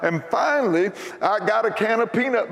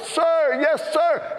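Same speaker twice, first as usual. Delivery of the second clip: high recruit's shout of sir, yes sir; yelled reply; 9 semitones higher, much slower than usual. Retold or imitated. Recruit answering the drill sergeant, a high parade-ground yell. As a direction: bark it back at the top of your lungs. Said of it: "I yelled".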